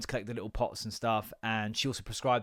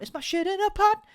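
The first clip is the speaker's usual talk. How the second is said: mock alarm; 4 dB louder than usual.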